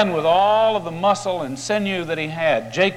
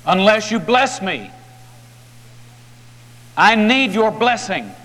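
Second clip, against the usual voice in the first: higher and louder, clinging on; desperate plea; 5 semitones higher, 3 dB louder than usual. A narrator's reading. Jacob holding on to the stranger, loud pleading. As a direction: beg him not to leave, hard. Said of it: "Jacob says".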